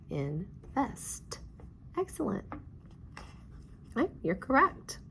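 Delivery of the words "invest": softer "Invest" in two beats; clapped-out syllables. Shown as a demonstration. Teacher repeating "Invest" for pupils, softly, syllable by syllable. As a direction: say "Invest" softly as in, pause, vest.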